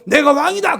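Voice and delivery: high, loud acted cry; imitated royal shout; king's proclamation. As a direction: bellow your rank over the din. King vainly asserts his rank.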